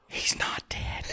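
breathy gasped whisper. Retold or imitated. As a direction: whisper it in shock after a gasp. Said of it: "the whole audience goes".

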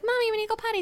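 put-on high, childlike daughter's voice; little daughter voice. A mother imitating her daughter asking for the potty in a high childlike voice. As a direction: pipe up like a little girl.

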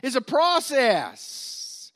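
slow, stretched, weighty; drawn-out teaching point; slow emphasis. Shown as a demonstration. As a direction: let it sink in slowly. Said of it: "slow emphatic restatement".

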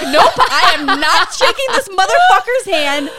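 high, loud laughing defiance; laughing refusal. Retold or imitated. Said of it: "I'm like".

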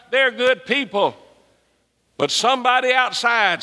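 high, driving preacher's voice; a pastor riled up about the Boy Scouts, preaching hard; fiery preaching. Acted. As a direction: preach it up with rising fervour. Preaching at full force, raised pitch.

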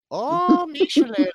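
high, loud stage Irish shout of "me shillelagh"; shouted mock Irish. Pal clowning, shouting "me shillelagh" in a mock Irish accent. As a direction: bellow it like a cartoon leprechaun. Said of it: "trying to come out with this Irish accent".

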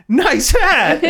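high, amused nice hat; mock delighted quip. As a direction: say it like a giddy fan. Imitating their reaction.

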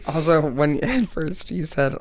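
ordinary talk

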